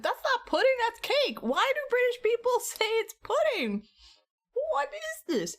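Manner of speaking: mock-baffled shriek about pudding; incredulous outburst. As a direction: act outraged at the British calling cake pudding. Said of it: "I was like".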